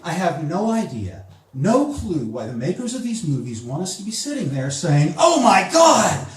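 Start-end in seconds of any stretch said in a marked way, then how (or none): 5.2-6.3 aghast movie viewer. Acted. Comic playing a horrified movie audience, aghast and loud. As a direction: exclaim in exaggerated disbelief, pitched high.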